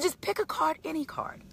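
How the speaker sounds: mock magician offering a card; magician patter. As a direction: tempt her like a sly magician. Mechanic insists, magician-like.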